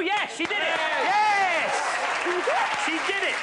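high, jubilant celebration; excited game-host shout, pitch soaring; triumphant cheer. Real, not acted. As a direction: cheer the caller's success. Caller answers with the phrase.

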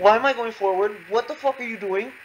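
high, loud, incredulous protest; high-pitched frustrated outburst; outraged yelling. Real, not acted. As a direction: yell at the game in disbelief. Yells as game moves wrongly.

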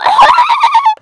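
very high squealing laugh; shrieking laugh. Real, not acted. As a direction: burst into a shrill shriek of laughter. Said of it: "I didn't know I could do this".

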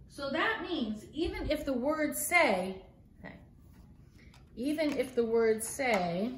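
ordinary delivery, nothing marked

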